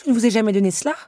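high, loud insistence; indignant denial. A narrator's reading. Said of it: "affirma Belle". (démenti indigné, voix haute)

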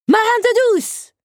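very high-pitched pirate yell; child's shout. A narrator's reading. Little teacup attacking the footstool, shouting. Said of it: "cria Zip".

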